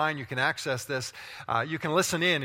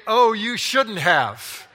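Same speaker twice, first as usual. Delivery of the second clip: loud sceptical replay; 4 semitones higher, 7 dB louder, much slower than usual. Retold or imitated. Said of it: "Did you really mean to say".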